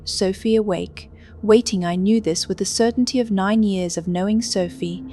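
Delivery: normal talking voice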